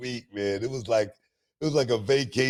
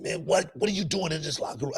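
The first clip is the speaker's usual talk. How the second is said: higher, fast indignant yell; retold angry yell; 9 semitones higher than usual. Booker confronting a stranger in his locker room, angry yell. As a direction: bark it at the intruder. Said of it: "I said".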